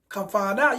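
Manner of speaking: ordinary delivery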